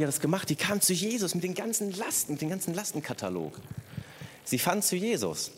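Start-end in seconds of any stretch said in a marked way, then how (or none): none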